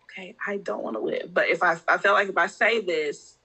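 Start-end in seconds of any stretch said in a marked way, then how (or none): 0.0-1.3 whispered under the breath, afraid to say it; a retold whisper of a secret fear; scared whisper. Scared to say it out loud.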